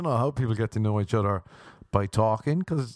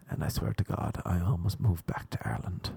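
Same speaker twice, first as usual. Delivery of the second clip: hushed aside; 4 semitones lower, 5 dB quieter than usual. Real, not acted. Hushed aside about flute.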